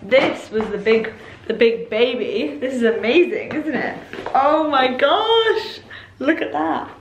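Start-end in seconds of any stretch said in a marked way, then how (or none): 4.3-6.9 slow drawn-out delight; awed. Real, not acted. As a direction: stretch it in wonder. Amazed at gift.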